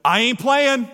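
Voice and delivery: loud, high pastor's catchphrase; emphatic catchphrase. Retold or imitated. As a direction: belt it out like the pastor's motto. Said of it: "You know what Pastor Hurst says?".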